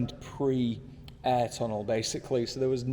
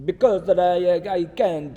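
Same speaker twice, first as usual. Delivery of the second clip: loud, higher-pitched fake Italian accent; mock Italian accent; 5 semitones higher, 7 dB louder, slower than usual. Reviewer playing the Italian car boss, mock Italian accent. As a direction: burst into a hammed-up Italian accent, then give up. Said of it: "I won't do an Italian accent".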